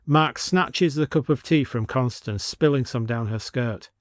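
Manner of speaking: usual speaking voice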